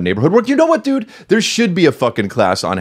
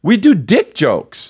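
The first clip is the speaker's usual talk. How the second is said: slow mock-proud; 8 semitones higher, much slower than usual. Joking about their job.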